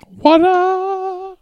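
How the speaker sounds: very high, long-held shout; squeaky hype call. Pretend. Co-host hypes each beer announced, high squeal.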